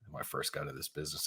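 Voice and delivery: sigh, then a hushed, hurried mumble; self-mocking mutter. Real, not acted. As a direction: repeat it under your breath, mocking yourself. Repeats own phrase, calls it ridiculous.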